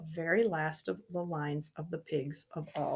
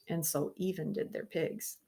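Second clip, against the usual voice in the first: plain reading voice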